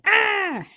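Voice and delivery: dismayed cry. Real, not acted. Reacting to Kelly's death.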